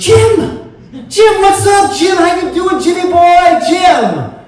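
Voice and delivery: booming, high-pitched mock holler of recognition; boisterous greeting. Giant guy grabs a supposed college friend, boisterous greeting. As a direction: holler it like an overexcited buddy.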